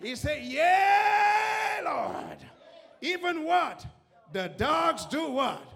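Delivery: loud shouted preaching over music; full-force preaching. Acted. Preacher rousing the congregation toward a miracle, loud shouting. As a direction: preach it to the rafters.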